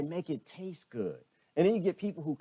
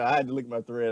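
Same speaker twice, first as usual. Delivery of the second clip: ordinary talk, nothing marked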